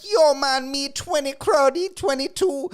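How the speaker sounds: very high, loud cartoonish Toronto accent; mock Toronto street accent. Imitating interviewed street guy, hammed-up Toronto accent. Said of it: "And the guy was like".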